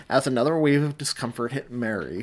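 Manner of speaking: ordinary delivery, nothing marked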